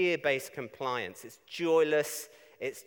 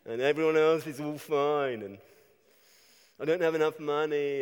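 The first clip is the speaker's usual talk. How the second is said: mock whiny self-pity; lightly hammed-up grumbling; self-pitying moan. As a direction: moan like a hard-done-by grump. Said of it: "you can start thinking".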